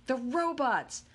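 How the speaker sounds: high, eager call-outs; voiced eager children; excited kids calling out. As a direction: shout the answers from the seats.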